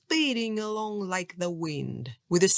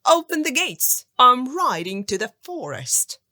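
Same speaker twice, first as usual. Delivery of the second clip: louder, higher command; shout; 4 semitones higher, 7 dB louder than usual. A narrator's reading. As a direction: bark the order to open up. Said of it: "he shouted".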